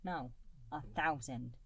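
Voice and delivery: usual speaking voice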